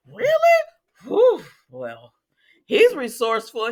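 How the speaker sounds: very high, loud "Really?" then drawn-out sarcastic praise; sarcastic mock surprise. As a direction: play Sam feigning innocence with a big sarcastic squeal. Sam voiced mocking Dante.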